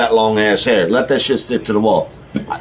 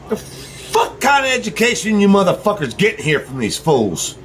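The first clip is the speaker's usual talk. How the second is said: ranting; 6 semitones higher than usual. Real, not acted. Ranting at Eli about censorship.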